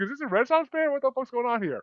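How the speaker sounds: high incredulous questions; bewildered. Retold or imitated. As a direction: act baffled by what you see. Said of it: "I'm like".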